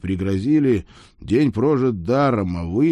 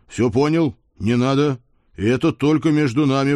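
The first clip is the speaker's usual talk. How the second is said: raised, higher pleading yelp; mild howl; 5 semitones higher, 4 dB louder than usual. A narrator's reading. Rider begs his blackmailing horse for secrecy, mild howl. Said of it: "взвыл я".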